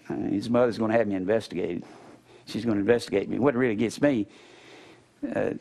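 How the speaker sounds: ordinary delivery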